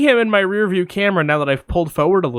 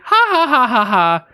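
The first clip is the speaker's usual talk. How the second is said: high, put-on laugh; mock neighbor laugh; 8 semitones higher, 4 dB louder than usual. Storyteller mimics neighbors amused by their cat, high fake laugh. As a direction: imitate a delighted laugh, starting high.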